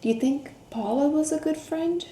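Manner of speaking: usual speaking voice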